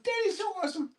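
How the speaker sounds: very high squeaky praise. Real, not acted. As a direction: push the pitch way up for the dog. Repeated higher to dog.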